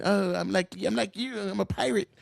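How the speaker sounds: a lightly voiced goofy imitation of the mayor; lightly mocking impression of a politician; mock silly voice. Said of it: "He does his little bunny dance".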